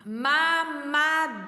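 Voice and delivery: mamadeira in long, separate syllables; sounded out slowly. Shown as a demonstration. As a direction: draw out each syllable for young learners. Teacher sounds out word slowly. (mamadeira devagar, sílaba por sílaba)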